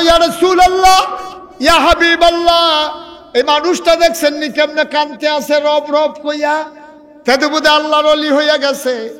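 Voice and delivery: high, loud, ringing sermon delivery; preacher loudly voicing the companions' appeal; full-force preaching. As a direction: call it out to the crowd on a high sustained pitch.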